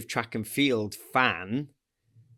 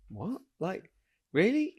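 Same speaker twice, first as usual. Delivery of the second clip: mock disbelief; 6 semitones higher than usual. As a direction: react in delighted disbelief. Disbelieving reaction retold.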